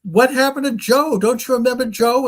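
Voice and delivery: high-pitched imitated disbelief; acted alarm, pitched well up; desperate questioning. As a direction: plead as if nobody believes you. Astronaut desperately questions his crewmate.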